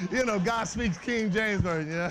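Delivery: usual speaking voice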